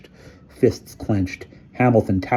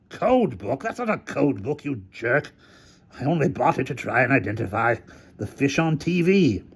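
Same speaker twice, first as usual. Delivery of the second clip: character voice; 6 semitones higher than usual. A narrator's reading. Hamilton retorts.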